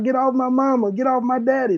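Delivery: high-pitched imitation of a pleading child; retold kids screaming. Children begging police to release their parents, high pleading cry. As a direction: cry out like a kid watching a parent pinned. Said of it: "You hear kids screaming out".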